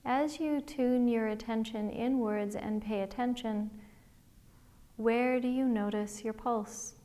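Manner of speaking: guided meditation. Acted. Liz leading listeners through a body scan, calm guided meditation voice. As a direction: gently guide listeners inward, one breath at a time.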